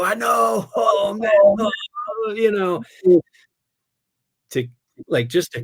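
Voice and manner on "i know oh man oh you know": high, loud mock-dismayed kid; a hammed-up groan of agreement, pitched high. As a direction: act the busted kid playing along. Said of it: "I go".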